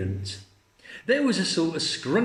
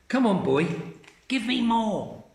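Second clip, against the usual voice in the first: Grandma; 7 semitones higher than usual. Acted. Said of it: "she said".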